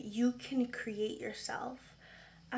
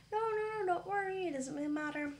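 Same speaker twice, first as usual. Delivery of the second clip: reassuring; 7 semitones higher than usual. Retold or imitated. Reassuring the apologising caller.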